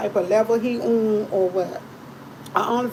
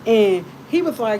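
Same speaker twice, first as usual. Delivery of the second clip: ordinary delivery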